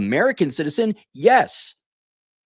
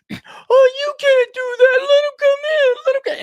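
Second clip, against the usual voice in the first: shrill falsetto mock of a whiny do-gooder, way higher and louder; 6 dB louder than usual. Mocking the virtue signalers in a high whiny falsetto. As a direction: squeal it like a hand-wringing do-gooder.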